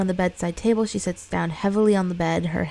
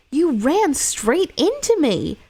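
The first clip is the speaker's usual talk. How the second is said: higher, louder, slow accusing shout; angry driver; 9 semitones higher, 5 dB louder, slower than usual. Acted. Corporate guy yelling at the woman who hit his car, slow and louder. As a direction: confront her like an outraged driver. Driver confronts after crash.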